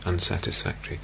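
a soft mental note, unsatisfactory; light whispered label. Shown as a demonstration. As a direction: whisper the word unsatisfactory softly. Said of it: "labeling them lightly as unsatisfactory".

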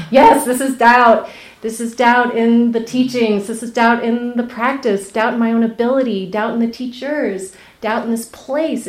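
loud, laughing torrent of admissions; gushing relief. Retold or imitated. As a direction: spill it all out at once, half laughing. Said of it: "this regurgitation of".

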